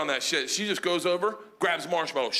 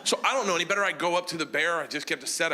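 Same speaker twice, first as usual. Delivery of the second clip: ordinary talk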